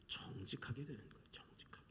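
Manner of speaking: whispered emphasis. Real, not acted. Preacher lowers voice for emphasis.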